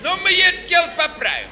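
быстро и громко, с азартом; как фокусник, спасающий номер; бодро. Acted. Фокусник бодро берётся исправить. (бодро и громко)

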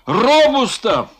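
a high, slow called-out name; shout. Acted. Foma calls out a merchant in front of all, shout. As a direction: call him out by name. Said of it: "кричал Фома".

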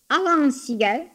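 high, drawn-out taunting question; teasing. A narrator's reading. Elder sister keeps baiting the boy, teasing. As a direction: stretch it out to needle him. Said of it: "продолжала дразнить его Лидия".

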